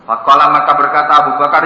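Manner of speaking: ordinary talk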